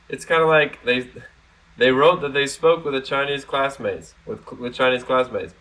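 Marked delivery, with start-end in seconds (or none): none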